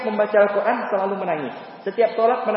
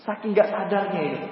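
ordinary delivery

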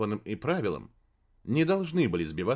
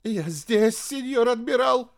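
very high frightened reply; trembling voice; 11 semitones higher, 4 dB louder than usual. A narrator's reading. Ship's radio operator answering admiral, trembling. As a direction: answer nervously in a pitched-up voice. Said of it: "дрожащим голосом ответил радист".

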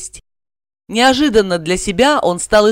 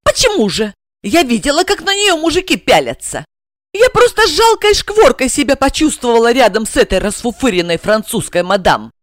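Regina's voice; 6 semitones higher than usual. A narrator's reading.

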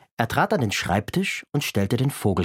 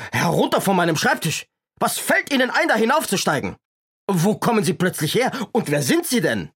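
scolding shout; 8 semitones higher than usual. Acted. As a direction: bark at the intruder on the desk. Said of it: "schimpfte Wachtmeister Dimpfelmoser".

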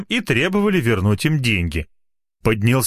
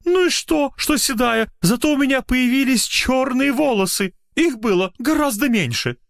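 put-on excited old lady.

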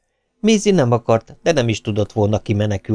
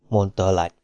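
plain reading voice

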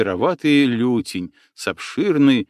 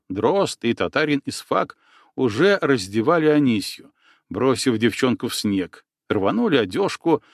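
ordinary delivery, nothing marked

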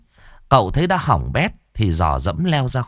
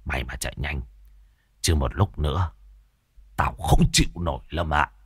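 put-on low, much softer old man's grumble; lamenting old man; 7 semitones lower, 6 dB quieter than usual. A narrator's reading. Môn Phọt lamenting to Lâm, low and soft. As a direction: moan it low and quiet. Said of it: "Môn Phọt mới than thở".

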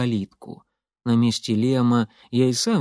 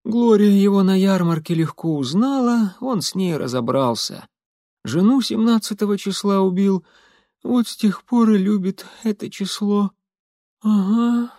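high-pitched Molly, a touch drowsy; 7 semitones higher than usual. A narrator's reading. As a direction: tell it in a raised character voice. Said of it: "Голос её становился всё более неразборчивым".

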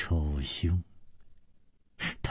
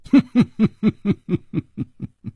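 knowing chuckle; 8 semitones higher than usual. A narrator's reading. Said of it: "他望着楚留香笑了笑".

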